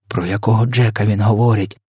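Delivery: ordinary delivery, nothing marked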